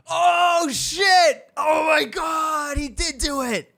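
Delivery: high, loud, drawn-out mock outcry; hammed-up wail of disbelief; acted shocked shout. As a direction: yell it like an astonished movie audience. Acting out audience's shocked reaction.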